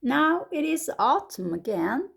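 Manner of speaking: plain reading voice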